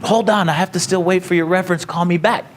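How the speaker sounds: put-on a lightly done Hawaiian accent. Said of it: "I'm doing a Hawaiian accent".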